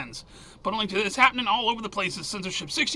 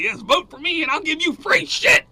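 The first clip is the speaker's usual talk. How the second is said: mock politician; 7 semitones higher, 4 dB louder than usual. Mocking the administration's vote buying.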